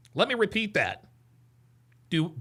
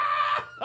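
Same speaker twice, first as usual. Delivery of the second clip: high laugh trailing off softer; helpless laughter; 4 dB quieter than usual. Real, not acted. Cracking up at video clip.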